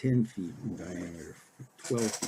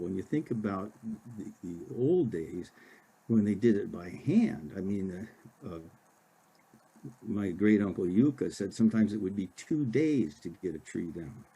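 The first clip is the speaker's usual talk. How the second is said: usual speaking voice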